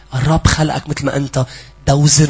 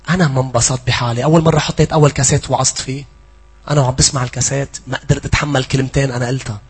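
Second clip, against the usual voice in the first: ordinary talk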